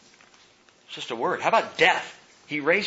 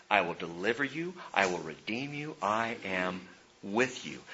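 much softer, hushed reassurance; God's soft promise; 4 semitones lower, 9 dB quieter than usual. Acted. Pastor voicing God's promise to the hurting, softly hushed. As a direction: hold it low and tender. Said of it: "but I did say".

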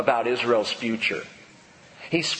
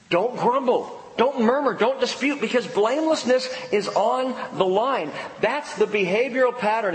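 higher, pressing sermon commands; forceful preaching; 8 semitones higher than usual. Acted. Pastor warning the congregation, fervent preaching. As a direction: drive each warning home.

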